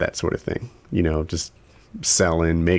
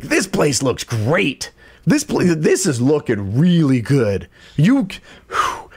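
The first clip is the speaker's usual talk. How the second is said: slow, gushing sales pitch; salesy praise; 5 semitones higher, slower than usual. Retold or imitated. Contractor hyping the cleaning to the homeowner, gushing. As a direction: oversell the cleaning job. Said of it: "Ed would come in and be like".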